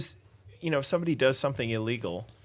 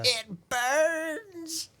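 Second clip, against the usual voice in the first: mock dramatic. Joking about fire.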